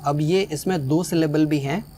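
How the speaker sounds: ordinary delivery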